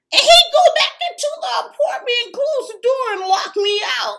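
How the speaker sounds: high, loud and fast, agitated; worked-up retelling. Retold or imitated. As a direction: rush it out loud, voice shooting way up. Retells being locked out, agitated.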